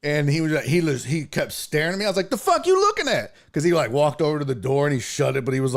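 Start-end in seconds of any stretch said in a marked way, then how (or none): 2.3-3.3 high-pitched retold challenge; hostile challenge. Passenger confronts staring driver, hostile challenge. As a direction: challenge the stare, indignant.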